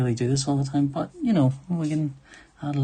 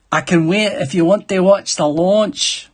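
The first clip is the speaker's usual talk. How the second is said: louder, slow, drawn-out Scottish accent; higher, emphatic Scots impression; 5 semitones higher, 6 dB louder than usual. Shown as a demonstration. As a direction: lay on a passable Scottish accent, loudly and slowly. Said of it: "I can do a passable Scottish accent".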